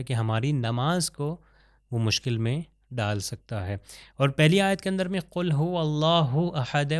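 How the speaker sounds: ordinary talk, nothing marked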